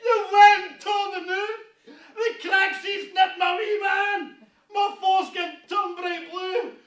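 high, frantic yelling in panic; panicked shouting. Acted. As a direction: cry out in horror to mammy and the Lord. Panic over the injury.

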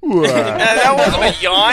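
loud laughing protest. Real, not acted. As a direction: laugh through the words. Laughing at the bad yawn.

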